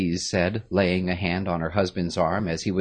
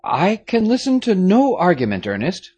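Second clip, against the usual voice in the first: firm commanding father, higher and louder; firmly; 13 semitones higher, 8 dB louder than usual. A narrator's reading. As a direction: shut down the argument firmly. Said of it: "the Marquis said firmly".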